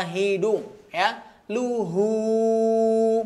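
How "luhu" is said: luhu without any nasal sound; clean luhu. Shown as a demonstration. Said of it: "bersihkan suaranya dari hidung". (luhu bersih dari hidung)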